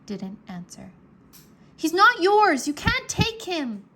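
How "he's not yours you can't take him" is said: put-on high, loud boyish shout. A narrator's reading. Drake shouting at Maldred to keep Worm, a high loud cry. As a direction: yell it at the wizard, defiant. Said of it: "Drake shouted at Maldred".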